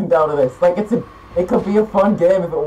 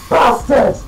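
loud, high curse; real outburst of gamer rage; angry shout; 6 semitones higher, 5 dB louder than usual. Real, not acted. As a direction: yell it at the enemy. Enemy fires at player.

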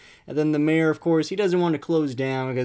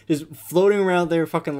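incredulous; 4 semitones higher, 5 dB louder than usual. Real, not acted. Mocking the toy shark.

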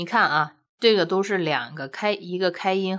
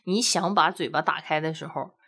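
ordinary talk

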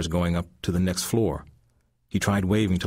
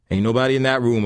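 loud, higher thug voice; intruder voice; 6 semitones higher, 7 dB louder, faster than usual. A narrator's reading. Thug telling his partner the room is empty, loud. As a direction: call it out from the other room.

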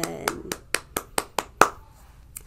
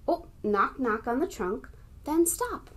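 normal talking voice

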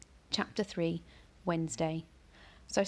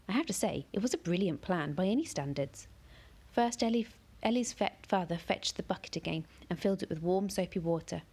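plain reading voice

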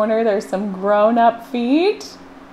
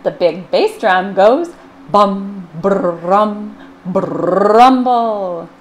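booming drum sound; much slower than usual. Acted. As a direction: boom it out like a bass drum. Reading a noisy drum sound.

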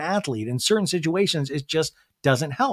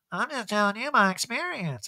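softer, offhand imitated Erica; imitating Erica; 4 semitones higher, 5 dB quieter than usual. Host imitates Erica's soft offhand remark to the group. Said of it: "And Erica's like".

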